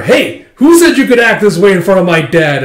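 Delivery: high, loud acted challenge; angry shout. Son confronts man disrespecting his dad, angry shout. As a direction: yell it while lunging in. Son lunges to punch him.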